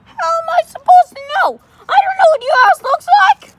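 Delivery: very high, loud, fast squeaky retort; squeaky exasperated. Acted. Octopus snapping at the visitors in a loud squeaky voice. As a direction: squeak it back, fed up.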